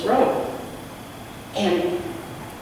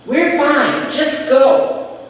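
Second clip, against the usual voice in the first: higher, loud, firm rejection; defiant refusal; 5 semitones higher, 7 dB louder, slower than usual. A narrator's reading. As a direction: loudly send the stranger away. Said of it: "one of them said".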